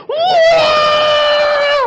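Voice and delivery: very high, loud shrieking wah; mock MC shriek. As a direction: shriek like an MC screaming into the mic. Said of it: "Bapak, bukan teriak loh, jerit".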